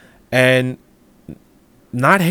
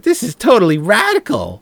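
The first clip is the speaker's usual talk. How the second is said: put-on valley girl voice, pitched up; airy teen girl gushing; mocking teen impression; 9 semitones higher, 4 dB louder than usual. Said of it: "she's like".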